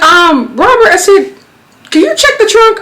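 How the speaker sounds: high call across to Robert. Retold or imitated. Asking Robert from the car to check the trunk, high call.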